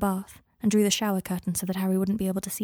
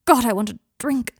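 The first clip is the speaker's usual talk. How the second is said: sudden loud hard outburst; a sudden hot, hard cry of longing; hard, burning hot; 3 semitones higher, 7 dB louder than usual. A narrator's reading. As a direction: let the craving burst out hot and hard. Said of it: "his voice suddenly hard and burning hot".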